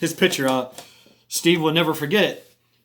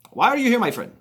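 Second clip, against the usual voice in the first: high-pitched, rough Indonesian accent; attempted Indonesian accent; 10 semitones higher, 4 dB louder, faster than usual. Shown as a demonstration. Tam asking Steve why he came, attempted Indonesian accent. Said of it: "It's kinda like that".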